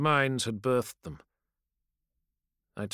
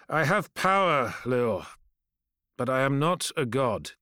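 louder, raised, final refusal; firm refusal; 2 semitones higher, 3 dB louder than usual. Acted. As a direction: draw the line with force. Khayon refuses Leor firmly.

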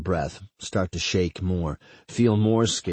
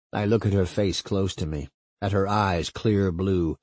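ordinary delivery

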